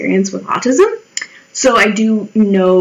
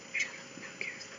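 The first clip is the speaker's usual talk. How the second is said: whispered retort; 28 dB quieter than usual. Retold or imitated. Muttered reaction to goths.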